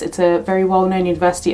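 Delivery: ordinary talk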